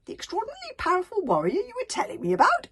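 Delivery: high, loud astonished question. A narrator's reading. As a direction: gasp it out in disbelief. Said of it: "asked the fat consul".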